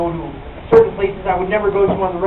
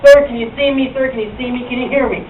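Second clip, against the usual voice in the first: condescending flight attendant; 5 semitones higher, 7 dB louder than usual. Retold or imitated. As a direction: talk down to him like he can't understand. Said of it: "She was like".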